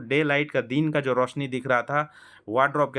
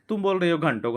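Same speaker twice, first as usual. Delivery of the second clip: normal talking voice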